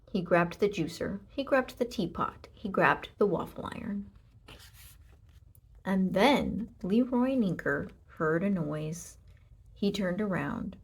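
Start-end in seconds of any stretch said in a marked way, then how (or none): none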